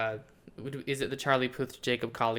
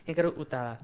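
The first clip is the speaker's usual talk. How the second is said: put-on Spanish accent; 2 semitones higher, faster than usual. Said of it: "I said that with a Spanish accent".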